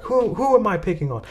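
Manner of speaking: lightly imitated Chinese-accented teacher's question; Miss Fang. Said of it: "And a very harsh, um, Chinese accent".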